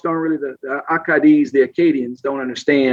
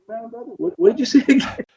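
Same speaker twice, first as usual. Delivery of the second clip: baffled listener; 10 semitones higher than usual. Retold or imitated. Can't understand Jerome's accent.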